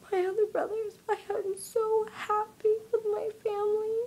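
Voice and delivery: real sobbing, high and loud; crying. Real, not acted. Girl thankful and sorry about her family, crying hard. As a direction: talk through tears as the voice climbs.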